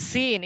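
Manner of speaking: সিন with a light s and spread lips; thin, like English "see". Shown as a demonstration. Teacher showing the thin letter, light সিন. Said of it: "ইংরেজি সি এর মতো".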